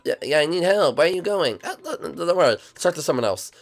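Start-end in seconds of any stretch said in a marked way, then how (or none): none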